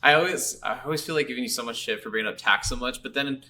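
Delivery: normal talking voice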